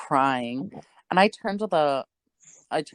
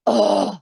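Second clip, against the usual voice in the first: loud exasperated huff; disgusted ugh; 6 dB louder than usual. Retold or imitated. Angry customer fed up with the manager, loud huff. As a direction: imitate a scoff of disgust. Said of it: "she just goes".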